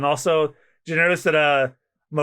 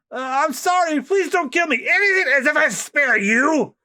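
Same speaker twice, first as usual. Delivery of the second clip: much higher, slow begging voice; mock pleading; 13 semitones higher, 3 dB louder than usual. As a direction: beg for your life in a squeak. Victim begging for life.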